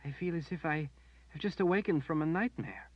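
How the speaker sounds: dazed relief. A narrator's reading. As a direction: speak as if just woken. Berdine waking from torment.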